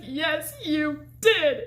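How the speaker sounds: high, loud mock-tearful retort; hammed-up insistence in a back-and-forth quarrel. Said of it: "on the brink of tears".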